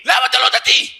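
high, attacking yell; shouted comeback. Shown as a demonstration. Student turning the question on his heckler, shouting. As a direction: fire the question back at a shout. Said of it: "אתה צועק לו".

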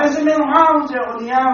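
high, loud, indignant retort; indignant outburst. Retold or imitated. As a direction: scoff at the idea of rest. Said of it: "אמר". (מחאה רמה וחדה)

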